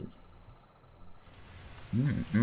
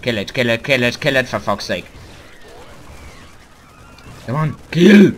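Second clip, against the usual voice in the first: loud rapid-fire repeated command, rising to a yell; battle yell building to a high scream; frantic urging; 4 semitones higher, 10 dB louder than usual. Real, not acted. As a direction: shout them on, exasperated.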